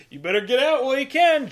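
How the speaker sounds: loud, high mock warning; taunting shout. Villain to driver as the car goes down, taunting shout. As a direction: call it out as a parting jab.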